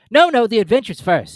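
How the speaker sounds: high, loud, impatient Griffin; an impatient high, loud creature. A narrator's reading. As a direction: brush it aside, impatient. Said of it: "in an impatient tone".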